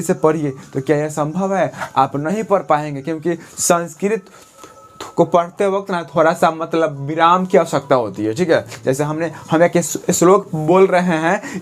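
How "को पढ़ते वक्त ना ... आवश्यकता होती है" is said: ordinary talk, nothing marked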